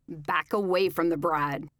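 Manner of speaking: loud menacing growl; growling kidnapper. A narrator's reading. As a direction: growl it like a threatening gunman. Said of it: "the dark stranger growled".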